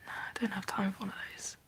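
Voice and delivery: soft weary whisper. Acted. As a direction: breathe it out, exhausted. Tired quiet confession.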